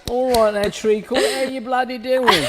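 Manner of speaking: loud, high, hammed-up English greeting; cheeky English accent, played for laughs; English accent impression. Shown as a demonstration. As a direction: put on a big jokey English accent. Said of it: "Did you hear my English accent impression?".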